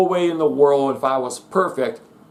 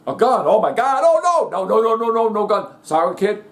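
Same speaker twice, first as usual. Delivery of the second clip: mock panic; 5 semitones higher than usual. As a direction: ham up a panicked fair worker. Mock fair worker panicking at gun.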